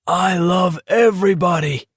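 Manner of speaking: high, joyful bellow. Acted. Walker bellowing joy to everyone, a light high bellow. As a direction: shout it with juice on your face. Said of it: "Abraham bellowed".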